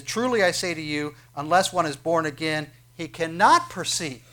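preaching emphasis. Acted. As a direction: lean on each phrase as you proclaim it.

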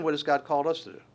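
ordinary delivery

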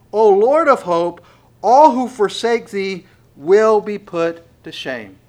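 loud, high, impassioned scripture reading; preaching at full force. Acted. Preacher to his congregation, loud impassioned preaching. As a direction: thunder the verse out to the church, slow at first.

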